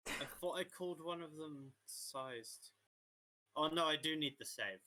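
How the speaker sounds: ordinary talk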